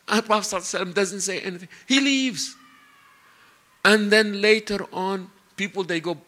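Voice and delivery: ordinary talk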